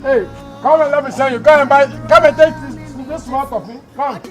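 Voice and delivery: high-pitched hollering over; calling out. Acted. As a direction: summon them from a distance, pitch right up. Calling someone over for an errand.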